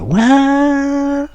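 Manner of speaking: very high, drawn-out mock "what"; incredulous. Replying to a police accusation, high incredulous disbelief. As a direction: act out baffled disbelief, pitch shooting way up.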